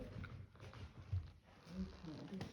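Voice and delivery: whispered question. Real, not acted. Medium whispering to the spirit, slow hushed whisper. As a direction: breathe the question out slowly, barely voiced.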